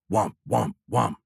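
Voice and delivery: droning alarm "womp", evenly repeated; imitated alarm. A narrator's reading. Cockpit alert drills into the pilot's brain, imitated alarm. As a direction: voice a cockpit warning tone, pulse by pulse. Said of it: "Even the alert sounds wrong".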